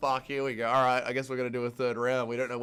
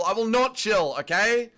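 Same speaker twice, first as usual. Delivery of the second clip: raised, higher defiant voice; indignant refusal; 6 semitones higher, 5 dB louder than usual. Real, not acted. Streamer told to chill, indignant refusal. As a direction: push back loudly at being told to calm down.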